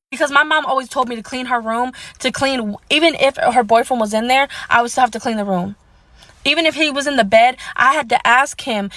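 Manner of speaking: ordinary talk, nothing marked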